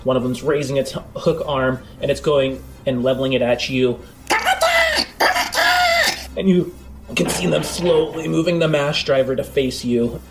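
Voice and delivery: ordinary delivery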